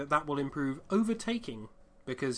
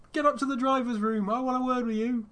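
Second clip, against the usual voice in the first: high, louder stern official; shouting race director; 9 semitones higher, 4 dB louder than usual. Retold or imitated. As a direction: bark it like an official. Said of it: "and shouting".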